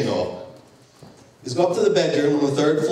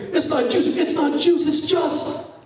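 much higher, urgent cry of discovery; retelling his wife grabbing him, thrilled and much higher; excited realization; 12 semitones higher than usual. Retold or imitated. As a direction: blurt it out as it dawns on you.

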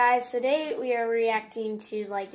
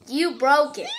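shouting; 4 semitones higher, 9 dB louder than usual. Real, not acted. Repeated shouting.

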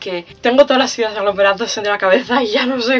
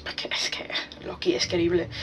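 whisper; 4 semitones lower, 11 dB quieter than usual. Real, not acted. Fond confession about Loki.

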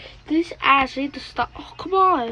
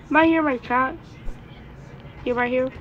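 usual speaking voice

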